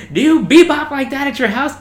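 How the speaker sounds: high, loud mocking chief question; mock chief yell. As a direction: shout the mocking question again. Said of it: "but I just love that".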